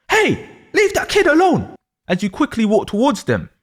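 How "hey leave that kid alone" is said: raised high angry call. Acted. Pupil shouting at bullies, high angry call. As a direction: yell it to stop the bullies. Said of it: "So you get very angry".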